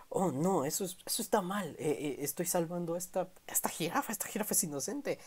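high, hushed, half-whispered protest; high whispered protest, half to himself; voicing Legoshi. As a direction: protest in a high, breathy hush. Legoshi's objection voiced.

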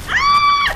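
very high, loud shriek; piercing yelp; startled scream. Real, not acted. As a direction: scream on suddenly spotting the opponent. Scream on spotting opponent.

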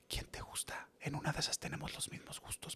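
soft, conspiratorial whisper; hushed, confiding whisper to a teenage daughter. Retold or imitated. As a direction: lean in and whisper it like a secret. Father confiding secretly in daughter.

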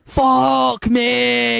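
high, drawn-out Scouse exclamation; mock Scouse outburst, stretched and high-pitched; Darren Till. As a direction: imitate a Scouse shout of amazement. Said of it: "I can hear that in your Scouse voice".

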